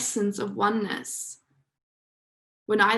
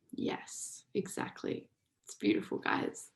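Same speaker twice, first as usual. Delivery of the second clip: whisper; 10 dB quieter than usual. Real, not acted. Reacting to chat comments.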